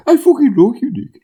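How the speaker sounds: high-pitched mock impression of a mate; imitating Stu. Imagining the friend telling Nick he likes him, high mock voice. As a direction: put on the friend's voice, pitched right up. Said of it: "I can almost hear him saying".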